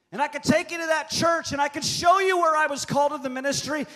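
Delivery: loud, high pulpit cry; full-force preaching shout; shouting preacher. Real, not acted. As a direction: proclaim it to the back row. Preacher building to a climax.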